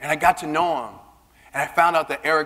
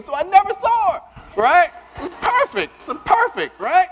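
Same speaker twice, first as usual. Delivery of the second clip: much higher, louder gleeful joking; 3 dB louder than usual. Real, not acted. Joking about never seeing Nicole, high gleeful crowing. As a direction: crow about it with delight. Excited joke about distance.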